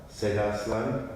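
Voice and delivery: ordinary delivery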